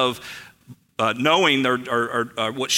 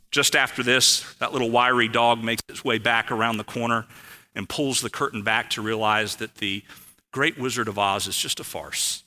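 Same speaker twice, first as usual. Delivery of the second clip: ordinary talk, nothing marked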